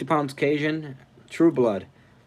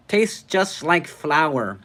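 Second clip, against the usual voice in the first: drawn-out, higher put-on viewer voice; Kevin's voice; 5 semitones higher, 4 dB louder, much slower than usual. Streamer reads out a viewer's chat comment, imitated voice. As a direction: stretch it out in a raised mock voice. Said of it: "Is that how you sound, Kevin?".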